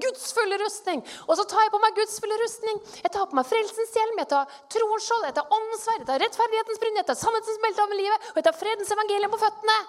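high-pitched, breakneck recital of spiritual armour; frantic declaration. Retold or imitated. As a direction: rattle it off with frantic fervour. (ivrig, lys og oppjaget proklamasjon)

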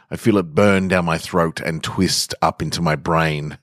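usual speaking voice

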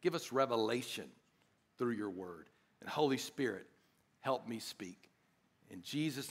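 soft, low, measured prayer; hushed, reverent plea to God. Acted. Pastor praying to the Lord before preaching, softly reverent.

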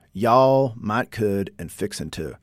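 "Y'all, might, could, and fixin' to" in a natural Southern accent; unplanned Southern twang on "Y'all". Shown as a demonstration. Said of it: "really hard for me to say that without a Southern accent".

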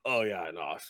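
low, soft offhand mumble; resigned red cap. Acted. Red cap driver excuses the goblin to passenger, low and offhand. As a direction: excuse your odd companion, low and quiet. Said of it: "looks back at the goblin and goes".

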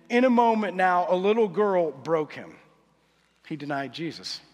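ordinary talk

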